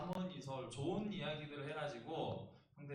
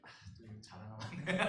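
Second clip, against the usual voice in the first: usual speaking voice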